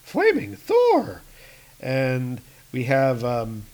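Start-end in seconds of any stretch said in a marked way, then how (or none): 0.0-1.2 high, slow amazed exclamation. Acted. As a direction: marvel at the sight. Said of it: "he's like".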